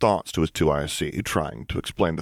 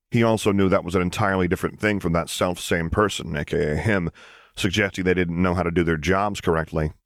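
ordinary delivery

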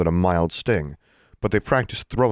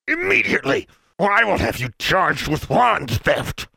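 shouting Mister Brown; 11 semitones higher than usual. A narrator's reading. Furious official orders the girl to return wands.

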